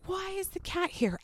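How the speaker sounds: high, soft imitation of a little girl; complaining child. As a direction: voice a kid's high-pitched complaint. Retelling child complaining about cat.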